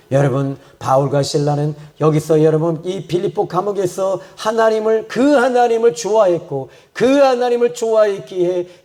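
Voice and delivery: high-pitched, fervent sermon with driving repetition; impassioned preaching. Real, not acted. Preacher urging the congregation with Paul and Silas' praise in prison, fervent.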